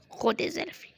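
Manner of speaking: unvoiced whisper of "خد يا زلفي"; whispered taunt. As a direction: hiss the catchphrase under breath. Catchphrase about Granny's hit. (همس ساخر)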